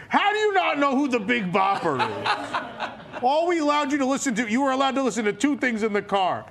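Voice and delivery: hammed-up exasperated mother; indignant mother. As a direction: scold in disbelief, half laughing. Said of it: "I probably have a text from my mother".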